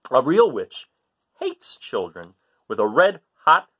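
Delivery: put-on lively read-aloud to children; story reading. A narrator's reading. Teacher reading to kids, lively storytelling voice.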